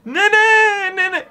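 high, loud call. Real, not acted. Gamer calls out to grandma in game. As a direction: shout her name across the room.

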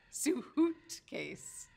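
Sue Hoot case said slowly, each part apart; slow, teasing pun delivery; pun spelled out. Shown as a demonstration. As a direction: drag out the pun so they hear suitcase. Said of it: "Get it?".

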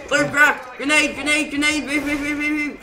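high loud panicked warning; grenade warning yell. Real, not acted. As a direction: shout it as the grenade lands. Grenade lands, warning teammate.

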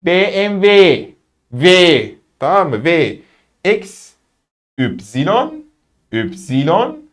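normal talking voice

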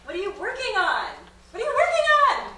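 high, loud, laughing mock eager; mocking the question. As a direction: ham up the nosy party guest. Exaggerated repeated party question.

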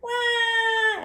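put-on high, loud baby bear wail; pretend cry. A narrator's reading. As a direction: wail like an upset little bear. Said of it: "Everybody cried".

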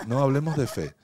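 firmly steer the talk back to faith; insistent. Real, not acted. Insists on changing topic.

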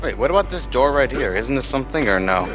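ordinary talk

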